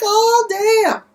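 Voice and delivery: high, loud startled gasp of a line; shocked. Real, not acted. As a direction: jump way up in pitch, stunned. Own shocked reaction to scene.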